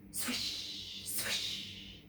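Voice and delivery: breathy whoosh of a swing; whispered swish. A narrator's reading. Swing sound effect.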